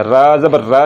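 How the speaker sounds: "रा ज़बर रा" with a light, open "aa"; wrong-way demo, light "रा"; thin R. Shown as a demonstration. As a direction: keep "रा" thin and unrounded. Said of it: "नहीं पढ़ना".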